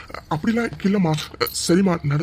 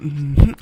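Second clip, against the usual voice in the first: happy hum; 6 semitones lower, 7 dB louder, slower than usual. Acted. Husband alone, nervous and giddy.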